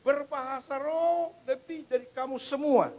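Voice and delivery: slow, high, loud preaching of the verse; drawn-out loud sermon declaration; emphatic proclamation. Acted. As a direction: proclaim it like Paul himself. Preacher quoting Paul emphatically.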